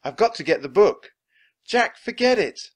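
put-on loud, high children's calls; urgent calling back and forth. A narrator's reading. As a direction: call out insistently across a distance. Children calling to each other urgently.

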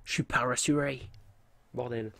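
mimicking the Zambla accent as a joke. Gamer mocking the game character's Zambla accent. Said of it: "je le fais à peu près aussi bien que Zambla".

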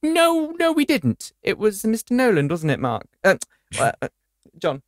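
hammed-up indignant no, fading into stammering; mock flustered spokesman.

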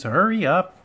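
high, loud hurry-up call; impatient urging. A narrator's reading. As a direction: nag a dawdler to move. Impatient coaches urge Thomas on.

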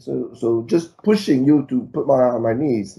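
ordinary delivery

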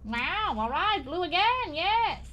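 high, swooping servile voice; hammed-up obedient minion; deferential underling. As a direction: greet a superior in an eager, high put-on voice. Voices game characters reporting to sir.

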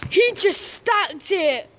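usual speaking voice

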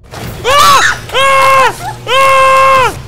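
piercing high screams of real fright; three loud startled screams; terrified screams. Real, not acted. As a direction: shriek in panic at the jump scare. Jump scare in horror game.

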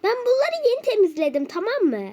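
high, loud angry complaint; fed-up high scolding. Real, not acted. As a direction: snap at him over the clean floor. Wife scolds over cleaned floor.

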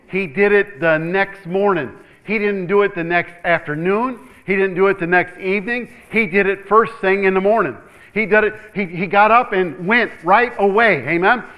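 loud, driving preacher's build; full-force sermon delivery; preaching at full force. Real, not acted. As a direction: press it home to the congregation.

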